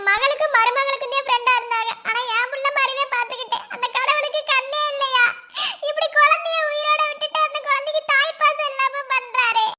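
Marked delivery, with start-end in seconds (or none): 1.1-9.7 higher, crying complaint to God; tearful lament. Acted. Mother-in-law crying out to God over the young mother, tearful. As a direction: wail at the unfairness.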